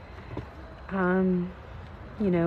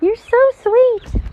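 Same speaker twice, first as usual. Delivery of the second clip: high loud coo; 11 dB louder, slower than usual. Real, not acted. Cooing to pet, louder.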